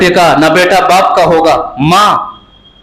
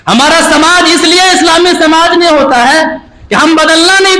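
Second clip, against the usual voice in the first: raised, driving sermon voice, pressing each line home; impassioned preaching; 8 semitones higher than usual. Acted. Preacher scolding listeners for not living by Quran, fervent preaching. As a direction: preach it at full force to the crowd.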